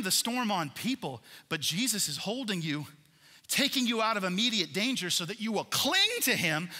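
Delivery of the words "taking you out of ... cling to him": ordinary delivery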